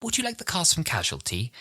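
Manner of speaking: high-pitched tentative offer; imitating polite staffer. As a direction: mimic someone politely interrupting. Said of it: "someone coming and tapping us on the shoulder and going".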